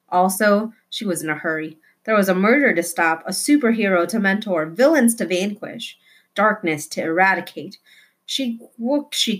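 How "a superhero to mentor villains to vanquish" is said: ordinary delivery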